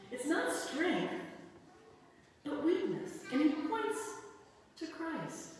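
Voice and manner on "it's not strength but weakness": slow, high emphasis on "not", then "weakness"; stress on not. Acted. Preacher correcting the church on strength: stressed "not". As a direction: lean hard on "not" in "It's not strength", slowly.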